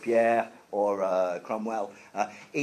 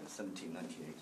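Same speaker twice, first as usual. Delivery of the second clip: soft, much quieter Seventeen ninety-eight; quiet repeat; 8 semitones lower, 21 dB quieter, faster than usual. Shown as a demonstration. Teacher softly repeating the year for students. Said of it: "I know that numbers are sometimes difficult".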